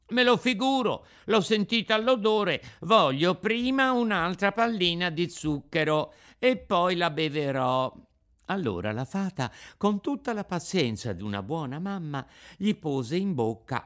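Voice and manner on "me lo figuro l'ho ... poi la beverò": put-on high, loud boyish protest; Pinocchio, whining puppet child. Pinocchio refusing the Fairy's bitter medicine, loud whining. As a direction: wail like a balky puppet boy.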